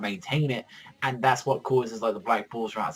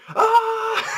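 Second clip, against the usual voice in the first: loud, very high mock scream; falling scream; 7 dB louder than usual. Asta falling to his death, high mock scream. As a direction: scream as if plunging, pitched way up.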